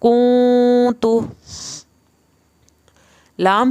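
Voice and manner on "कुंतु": कुंतु drawn out slowly with the n hidden in a nasal hum; hidden nun, ikhfa. Shown as a demonstration. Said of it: "हम इसको क्या पढ़ रहे हैं". (कुंतु धीरे, नाक में इख़्फ़ा के साथ)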